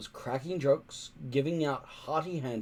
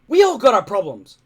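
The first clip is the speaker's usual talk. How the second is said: short, louder cross reply; a sharp, cross retort; cross snap; 8 semitones higher, 13 dB louder than usual. A narrator's reading. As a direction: snap it back, irritated. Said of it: "snapped Hiccup crossly".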